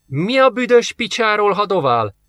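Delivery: high, indignant call across the room; odakiáltás. A narrator's reading. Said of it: "kiáltott oda a rendőrnek Geoff". (felháborodottan odakiáltva)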